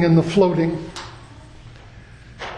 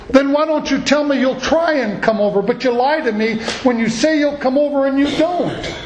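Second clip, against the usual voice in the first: high-pitched accusing complaint; angry friend calling out the preacher; furious friend; 6 semitones higher than usual. Retold or imitated. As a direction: throw the broken promise back at him. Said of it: "he was just furious".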